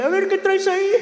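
high-pitched curious question; nosy friend. Retold or imitated. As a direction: ask it high, as a prying friend.

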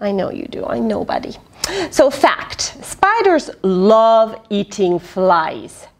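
ordinary delivery, nothing marked

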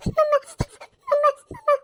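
put-on high, loud wail of a small child; crying for mother. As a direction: wail out for mummy. (रडत आईला मोठ्याने हाक)

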